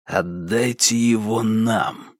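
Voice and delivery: slow, soft, cautious demand; guarded and quiet; careful, measured. A narrator's reading. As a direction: choose each word with care. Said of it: "осторожно подбирал слова".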